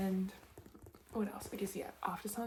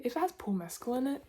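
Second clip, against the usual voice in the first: ordinary talk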